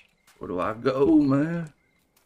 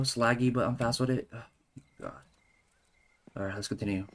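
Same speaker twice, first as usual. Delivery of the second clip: normal talking voice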